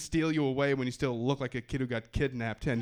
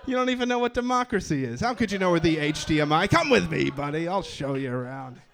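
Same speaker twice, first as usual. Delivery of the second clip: condescending clerk; 2 semitones higher, 7 dB louder than usual. Retold or imitated. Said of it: "They're like".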